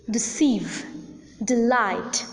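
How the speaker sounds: deceive and delight stressed on the second syllable; deCEIVE, deLIGHT, first syllable weak. Shown as a demonstration. Teacher shows learners words like deceive, delight, stressed on the second syllable. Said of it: "the second syllable is accented".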